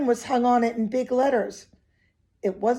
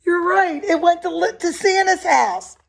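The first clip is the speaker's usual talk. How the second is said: higher, bright happy announcement; excited reveal; 7 semitones higher, 4 dB louder than usual. Acted. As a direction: tell the kids the happy news. Excited answer to the children.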